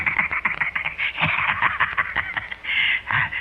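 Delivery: shrill breathy titter; high giggle. Acted. Old drunk giggling after the killing. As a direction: squeak out a giggle. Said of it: "he giggles again".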